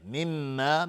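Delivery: "مما" with the doubled m held in a hum; ghunna. Shown as a demonstration. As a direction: hum the m of "مما" for two beats. (غنه روی میم مشدد «مما»)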